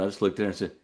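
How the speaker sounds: usual speaking voice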